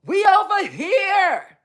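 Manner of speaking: high, loud mock shout across the room; imitating a crowd hollering for attention; mocking their call. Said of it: "Y'all said".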